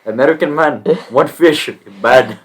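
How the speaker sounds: hammed-up broken-English angry man; mock Indian accent. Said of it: "This guy is very angry".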